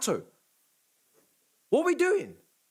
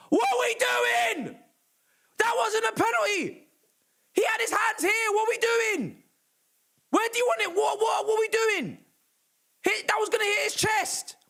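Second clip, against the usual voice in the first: real outraged yelling, much higher; furious shout; 7 semitones higher than usual. Real, not acted. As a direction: yell at the referee in disbelief. Furious at penalty decision.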